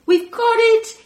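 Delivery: put-on high, loud sing-song kids' voices; children answering. Children telling the alien they caught the muffin, sing-song. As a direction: chant back like delighted children.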